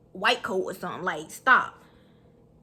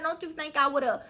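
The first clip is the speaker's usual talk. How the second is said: ordinary delivery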